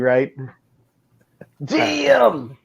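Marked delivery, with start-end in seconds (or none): 1.6-2.6 high, drawn-out New York "Damn"; New Yorkie damn. Retold or imitated. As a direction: toss off the movie line Yorkie-style. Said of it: "That's way more New Yorkie".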